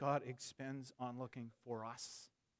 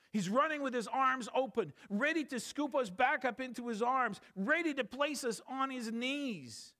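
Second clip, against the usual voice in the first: louder and higher, swelling with joy; fervent preaching build; rising preacher fervor; 9 semitones higher, 4 dB louder than usual. Acted. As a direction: lift it like a revival climax.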